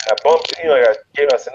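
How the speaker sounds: ordinary talk, nothing marked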